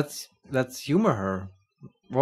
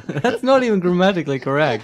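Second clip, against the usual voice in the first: laughing; 7 semitones higher, 7 dB louder than usual. Real, not acted. Laughing at botched line.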